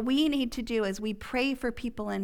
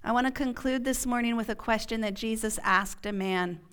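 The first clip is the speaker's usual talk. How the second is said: ordinary talk, nothing marked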